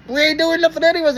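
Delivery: very high, exaggerated whine of innocence; exasperated protest. Driver asked if boys are done, exasperated protest. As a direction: act out protesting innocence, voice shooting up.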